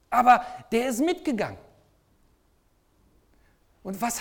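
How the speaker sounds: high and loud, driving sermon voice; full-force preaching. Acted. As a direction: preach it out, rising to a climax. Predigt mit voller Kraft. (mit voller Kraft predigen, hoch und laut)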